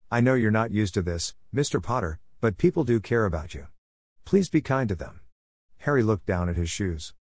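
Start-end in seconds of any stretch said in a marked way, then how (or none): none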